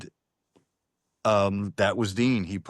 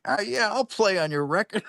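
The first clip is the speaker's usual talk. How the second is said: put-on New Orleans accent; 12 semitones higher than usual. Drummer Johnny agreeing to play, in a New Orleans accent. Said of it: "He's got one of these New Orleans accents".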